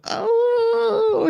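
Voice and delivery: very high whimpering oh; imitated dog cry. The dog crying as raindrops hit her head, a very high whimper. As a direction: whimper like a small dog in the rain. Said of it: "It's like".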